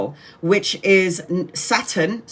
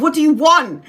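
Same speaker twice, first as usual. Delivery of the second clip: loud, high "what do you want", squaring up; defiant challenge; 7 semitones higher, 6 dB louder than usual. Retold or imitated. Confronting the shadow man in a dream, a loud defiant challenge. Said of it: "I'm like".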